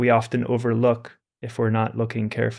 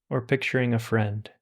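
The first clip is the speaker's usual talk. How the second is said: calm, unhurried meditation voice with long pauses; guided meditation; 3 dB quieter than usual. Acted. Teacher guiding listeners through meditation, calm and unhurried. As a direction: ease listeners into stillness.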